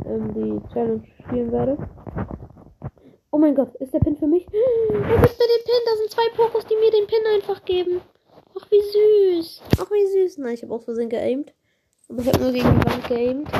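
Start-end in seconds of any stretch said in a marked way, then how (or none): 4.5-10.4 high, delighted and touched; high-pitched aww at the gift; delighted squeal. Real, not acted. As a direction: gush over a sweet gift.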